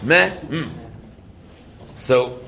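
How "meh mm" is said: "Meh, mm" with the mouth opening on "Meh" and closing on "mm"; Mem sound demo. Shown as a demonstration. Rabbi showing students how Mem opens and closes the mouth. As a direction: split "Meh, mm" into an open "meh" and a hummed "mm". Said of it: "you open your mouth and you close your mouth".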